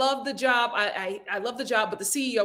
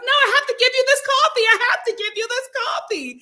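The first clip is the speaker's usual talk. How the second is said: hammed-up insistence; 13 semitones higher, 5 dB louder than usual. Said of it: "I was like".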